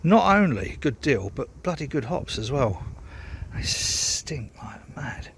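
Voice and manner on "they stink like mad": whispered aside, slow; hushed, slow whisper about the smell. Real, not acted. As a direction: lean in and whisper it like a secret.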